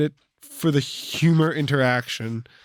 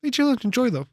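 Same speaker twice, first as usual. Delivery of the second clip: passive-aggressive; 8 semitones higher than usual. Real, not acted.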